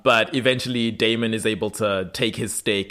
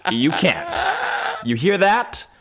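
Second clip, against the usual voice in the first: put-on Elijah's early accent; 7 semitones higher than usual. Elijah boasting to unseen foes in mock British accent. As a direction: savour the threat in a put-on English accent. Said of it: "The early, like, hybrid accent".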